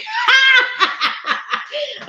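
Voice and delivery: squealing laugh rising high; high burst of laughter. Real, not acted. Laughing at her nutty crew, a high squealing laugh. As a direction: let out a big high laugh.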